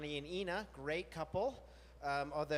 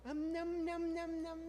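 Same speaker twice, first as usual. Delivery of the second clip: much higher playful nom nom; fake eating noises; 13 semitones higher, slower than usual. Coaxing the anxious dog to eat, much higher playful munching. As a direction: pretend to relish dog food. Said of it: "I will pretend to eat a bowl of dog food".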